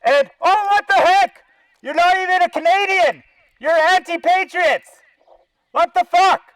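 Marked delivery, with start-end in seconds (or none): none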